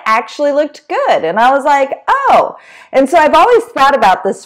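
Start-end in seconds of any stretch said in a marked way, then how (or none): 2.1-2.6 high-pitched retold "oh" of discovery; surprised realisation. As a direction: act out the penny dropping. Sudden realisation on seeing own lawn.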